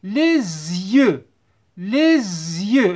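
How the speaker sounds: teacher demonstrating the Z in "Les yeux"; liaison with Z. Shown as a demonstration. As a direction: link "Les yeux" with a long buzzing Z. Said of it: "نديروا la liaison".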